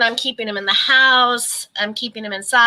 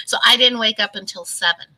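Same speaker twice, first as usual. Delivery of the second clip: ordinary talk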